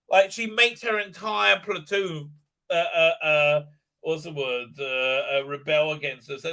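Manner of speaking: ordinary delivery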